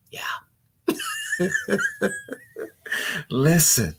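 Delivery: ordinary delivery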